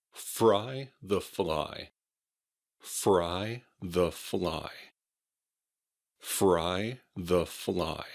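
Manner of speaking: very slow "Fry the fly", R and L kept apart; slow teaching model of "Fry the fly"; distinct R and L. Shown as a demonstration. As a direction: draw out "Fry the fly" word by word, clear R then clear L. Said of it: "R sounds in the same way".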